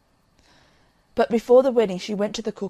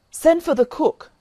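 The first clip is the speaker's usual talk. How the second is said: sharp raised order, higher and louder; commanding call; 7 semitones higher, 7 dB louder than usual. Acted. Sick young master summons the cook, commanding.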